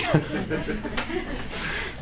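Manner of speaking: short soft laugh; quiet, amused laughing; amused chuckle. Real, not acted. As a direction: chuckle at the absurd story. Laughs at the donkey story.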